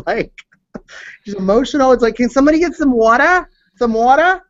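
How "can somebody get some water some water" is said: pleading, higher, quick mock plea; mock plea for water; mock begging for water. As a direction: ham up a parched castaway begging for water. Imitating hungry castaway begging.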